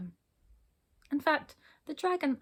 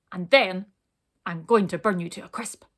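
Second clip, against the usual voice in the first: loud, punchy dragon threat; a dragon finishing its threat, bellowing; 8 dB louder than usual. Acted. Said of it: "bellowed the dragon".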